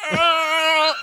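high drawn-out wail; high-pitched mock wail; mock agonized cry. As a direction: imitate a creature's cry mid-transformation. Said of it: "he's on his back going".

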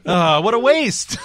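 high, slow, drawn-out lament; exasperated lament. Real, not acted. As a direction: groan it out high in dismay. Laments wasted actor's silly death.

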